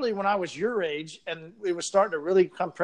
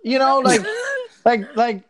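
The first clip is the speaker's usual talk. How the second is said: laughing; 6 semitones higher, 8 dB louder than usual. Real, not acted. Laughing at own joke.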